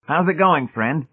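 a touch of craftiness, voice lifted; crafty. A narrator's reading.

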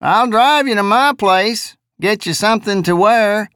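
high, drawling simple-minded voice; drawly jejune voice. Acted. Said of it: "drawly jejune voice".

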